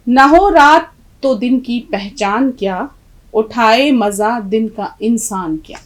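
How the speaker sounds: slow, measured verse reading, strong opening; poem recitation. Acted. Teacher reading the poem to students, slow measured recitation. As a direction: recite the couplet slowly, stretching the words.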